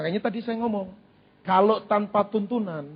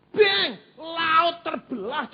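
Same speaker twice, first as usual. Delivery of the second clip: awed; 6 semitones higher, much slower than usual. Acted. Preacher voicing awe at sea parting.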